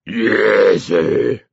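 growling dog. A narrator's reading. Dog answers the man's order, drawn-out growl. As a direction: growl it out like an obedient hound. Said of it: "the dog growled".

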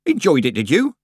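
Nanny's voice. A narrator's reading. Nanny questions Tiffany.

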